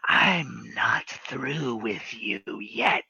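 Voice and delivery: slow, hissed whisper of a hag; sinister whisper. Acted. Hag holding the player's mouth shut, slow sinister whisper. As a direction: breathe the threat slowly into her ear. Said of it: "and she goes".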